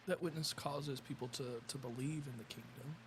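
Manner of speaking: faint, mic in the next room; distant off-mic voice; from another room. Real, not acted. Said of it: "You're real quiet".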